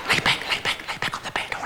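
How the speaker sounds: breathy whispered instruction. Acted.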